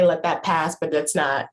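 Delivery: usual speaking voice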